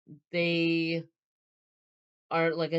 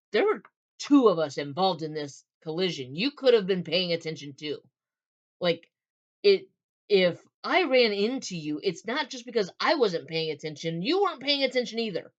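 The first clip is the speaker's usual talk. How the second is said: louder defensive retort, stressed words jumping up; pushing back; 3 semitones higher, 5 dB louder than usual. Acted. Man to woman after their collision, defensive. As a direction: argue back that blame is shared. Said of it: "he's very apologetic, but he's like".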